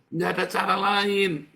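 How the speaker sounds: preached loud and high; forceful preaching shout; loud exhortation. Real, not acted. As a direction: hammer it home from the pulpit.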